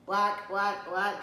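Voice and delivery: loud mock quacking; duck cries. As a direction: cry out like a duck. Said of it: "she cried".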